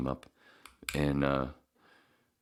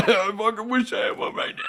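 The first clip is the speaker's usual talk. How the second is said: mock cheering; 6 dB louder than usual. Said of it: "everybody like".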